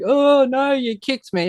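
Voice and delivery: high, whining mock complaint; mock whiny soccer player. Mocking soccer players to friends, high whiny voice.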